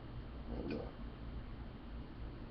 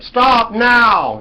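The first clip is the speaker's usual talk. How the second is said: sharp, much higher and louder command; bullhorn shout; 17 dB louder than usual. Retold or imitated. Mimicking a police bullhorn ordering a stop, loud shout. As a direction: bark it like a cop through a bullhorn. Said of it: "a bullhorn said".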